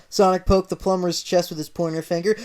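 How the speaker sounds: ordinary delivery, nothing marked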